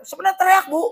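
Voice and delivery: ordinary talk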